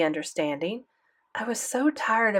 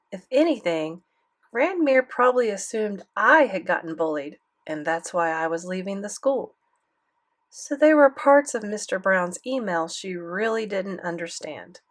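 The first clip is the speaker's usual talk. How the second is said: ordinary delivery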